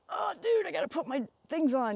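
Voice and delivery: high "Oh, dude" in mock alarm; mock surprised self. Retelling a NATO meeting to viewers, mock surprise. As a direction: play your past self caught out. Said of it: "and I'm like".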